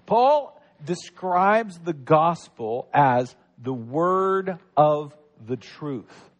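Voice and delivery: "word of the truth" drawn out very slowly, every word weighted; slow right down on "the word of the truth"; slow emphasis. Shown as a demonstration. Pastor stressing "the truth" to the congregation, slow and weighty. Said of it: "those definite articles".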